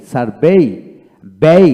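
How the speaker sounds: ordinary talk, nothing marked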